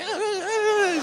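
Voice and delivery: high, quiet mock sobbing; hammed-up child's whimpering cries; scared kid whimper. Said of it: "the kid's like".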